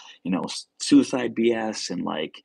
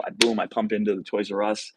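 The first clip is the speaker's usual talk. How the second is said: ordinary talk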